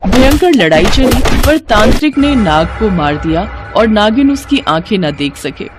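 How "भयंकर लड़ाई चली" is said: ordinary delivery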